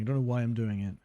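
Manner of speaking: usual speaking voice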